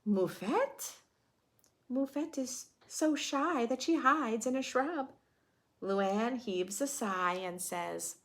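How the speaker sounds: put-on lively read-aloud for kids; children's story narration; storybook reading. A narrator's reading. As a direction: read the rhyme to a class. Reading picture book aloud.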